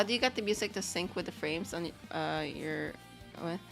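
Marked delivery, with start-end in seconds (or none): none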